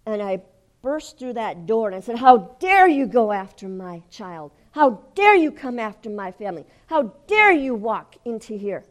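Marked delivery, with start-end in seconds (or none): 2.2-8.8 indignant, forceful challenge; commanding rebuke. Retold or imitated. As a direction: confront an intruder with full authority. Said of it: "marching in with all authority".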